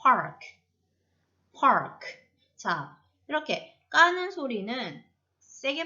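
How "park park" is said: park with a short, released k after the r; unforced final K. Shown as a demonstration. Teacher showing how the last sound lies low, park. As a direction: curl the r, lay a light k after park. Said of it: "옆에서 깔아버립니다".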